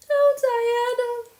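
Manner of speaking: drawn-out high, loud cry. A narrator's reading. Anne crying out to her friend, loud and drawn out. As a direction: cry her name out with yearning. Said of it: "cried Anne".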